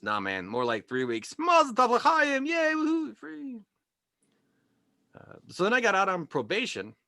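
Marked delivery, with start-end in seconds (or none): 1.4-3.6 high, slow hammed-up cheering; mock celebration. Comic celebrates getting out of juvie, high hammed-up cheer. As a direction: cheer like it's a wedding.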